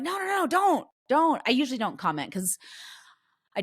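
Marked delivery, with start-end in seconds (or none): 0.0-1.4 much higher urgent no no; mock frantic plea to parents; alarmed protest. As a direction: cry out to stop them. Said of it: "I'm like".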